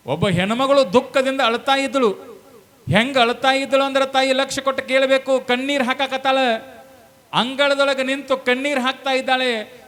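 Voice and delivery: high, loud sustained declamation; full-force preaching. Real, not acted. Preacher tells of a woman weeping, in full-force preaching. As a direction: proclaim the tale to a whole crowd.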